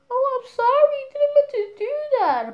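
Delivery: high, loud mock sympathy; put-on sorry voice. Friends responding to a crying girl, high put-on sorry voice. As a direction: imitate an exaggerated apology in a high sing-out voice.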